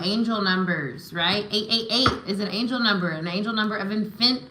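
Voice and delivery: ordinary talk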